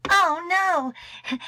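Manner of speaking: high, loud "oh no" of dismay; story character's shocked exclamation; dismayed cry. Acted. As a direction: cry out in alarm at damage. Dismay at finding damaged pages.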